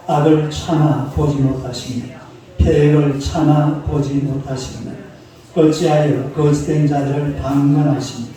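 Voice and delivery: solemn reading of Habakkuk, slowed on 패역을; scripture reading. Acted. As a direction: read the verse out with weight. Reads the scripture aloud.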